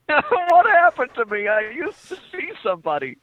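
hammed-up "what became of me" cry; mock lament. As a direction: wail it high in mock despair. Mock lament finishing friend's story.

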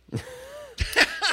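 very high, loud airy shriek of laughter. Real, not acted. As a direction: let out a piercing burst of laughter. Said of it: "laughs at the one-word reply".

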